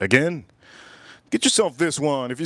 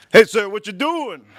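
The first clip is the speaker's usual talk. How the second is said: higher, louder cop voice; mock cop; 6 semitones higher, 6 dB louder than usual. Cop catching a driver tinkling by the highway, loud. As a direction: bark it like a cop on the roadside. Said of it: "Cop shows up".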